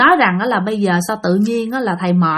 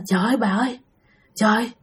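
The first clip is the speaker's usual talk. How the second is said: frightened hush; 7 dB quieter, faster than usual. Retold or imitated. Old man recounts terrifying dream.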